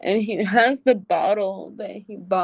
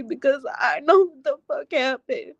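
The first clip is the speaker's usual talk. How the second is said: normal talking voice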